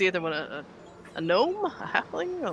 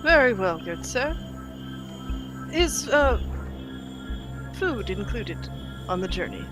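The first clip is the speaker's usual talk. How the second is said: raised, polite courtier; courtly traveler; 4 semitones higher than usual. Acted. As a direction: answer like a gallant. Player in courtly character.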